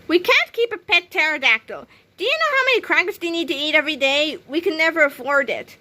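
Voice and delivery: high, insistent scolding; stern. A narrator's reading. George refusing Harold a pet pterodactyl, stern. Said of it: "said George sternly".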